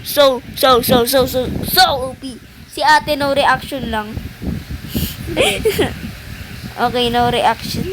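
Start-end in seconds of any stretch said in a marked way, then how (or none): 4.1-6.7 quiet, breathy high laugh; soft high giggle. Real, not acted. Gamer amused that a friend has no reaction, soft high giggle. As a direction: let out a small wheezy giggle.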